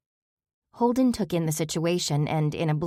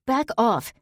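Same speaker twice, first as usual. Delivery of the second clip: shouting; 6 semitones higher, 3 dB louder than usual. A narrator's reading. Cornered by wolf, swinging stick.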